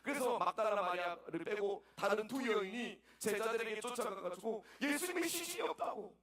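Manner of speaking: ordinary talk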